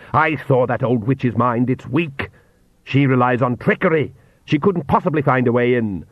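ordinary delivery

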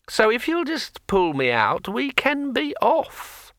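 high, slow, chatty sword voice; a cheerful magic sword rambling on; talking sword. A narrator's reading. Sword Kring speaks.

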